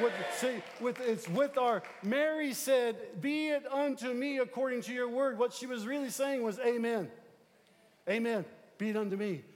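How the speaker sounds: loud, high preaching; fervent preaching. Acted. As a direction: preach it with fire. Preaching at full force.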